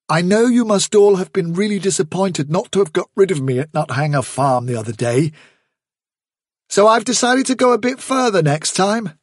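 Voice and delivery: put-on young leader addressing the warren; Hazel's calm resolve. Hazel voiced.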